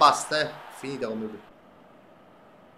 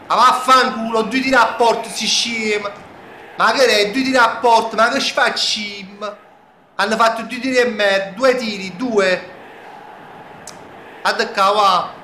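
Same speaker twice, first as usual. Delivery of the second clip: angry ranting; 4 semitones higher, 4 dB louder than usual. Real, not acted. Ranting after conceding.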